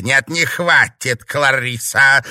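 loud, drawn-out angry refusal; barked. A narrator's reading. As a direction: cut down the objection with a bark. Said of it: "рявкнула четырёхрукая".

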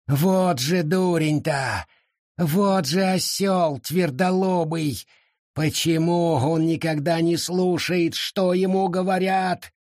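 high, slow exasperated rant; exasperated scolding. Acted. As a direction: scold a stubborn fool. Forest scolds reckless father.

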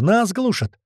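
high-pitched alarmed call; yelling down corridor. A narrator's reading. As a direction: cry out a warning to everyone. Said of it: "заорал Гера на весь коридор". (высокий тревожный выкрик)

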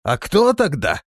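high, loud, slow question; surprised. A narrator's reading. Voice from under the floor, surprised. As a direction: ask in open astonishment. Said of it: "удивлённо спросили из-под пола".

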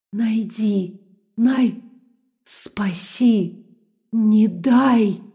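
eerie inhuman whispery voice; broken, otherworldly pleading; eldritch horror. A narrator's reading. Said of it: "Нечеловеческий голос вымораживал мозг".

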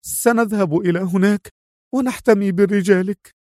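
lightly acted regret, not hushed; regretful. A narrator's reading. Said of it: "خافت يملأه الأسف".

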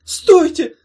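very high, loud squeal; panicked creature screaming; shrieking yell. Acted. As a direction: shriek to stop them. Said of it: "завопила коробка".

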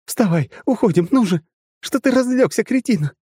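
high loud frantic yell; straining shout. Acted. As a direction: shout herself hoarse at him. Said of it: "надрывалась девица".

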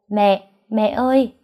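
put-on a child's call from the next room; little girl calling. A narrator's reading. Little daughter calling her mother. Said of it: "Tiếng của Dương cất lên".

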